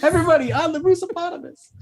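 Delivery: high, loud, quick rallying call. Acted. Game master calling the party onto the Moosopotamus, high excited shout. Acted call to board the creature.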